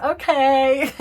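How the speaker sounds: startled okay; taken-aback okay. Retold or imitated. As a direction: blurt it out, caught off guard. Person answers the eager horse.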